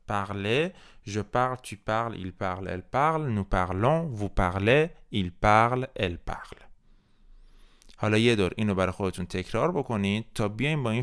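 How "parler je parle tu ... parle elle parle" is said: brisker connected list with short gaps; run-through recap. Shown as a demonstration. French teacher sums up the spoken forms, flowing list recitation. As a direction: rattle through the conjugation, falling on the last. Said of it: "پس ما حالت شفاهیشون این شکلی شد".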